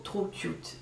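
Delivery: barely voiced, dropping away; hushed gush. Real, not acted. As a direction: let it melt into a hush. Repeats gush, softer.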